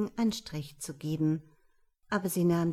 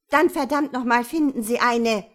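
loud furious yell; unrestrained shout; 4 semitones higher, 9 dB louder than usual. A narrator's reading. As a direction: scream it right in his face. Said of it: "schrie sie ihm hemmungslos ins Gesicht". (hemmungslos geschrien)

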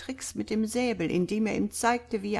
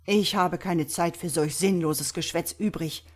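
usual speaking voice

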